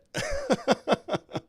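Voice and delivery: high run of giggles; helpless amused cackling; real laugh. Real, not acted. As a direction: laugh at your own failure. Laughs at butchered accent.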